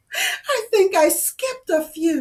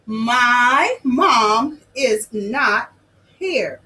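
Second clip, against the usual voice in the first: each word spaced out for the class to follow; slow, deliberate read-along; slow choral reading; 6 semitones lower, much slower than usual. Shown as a demonstration. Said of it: "Read it with me".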